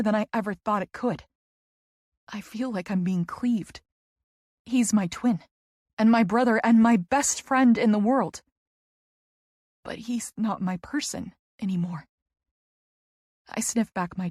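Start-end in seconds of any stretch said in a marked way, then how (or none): none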